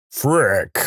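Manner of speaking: short mock yell from above; booming voice. Voice from above sees the bet lost, mildly booming. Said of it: "The booming voice yells".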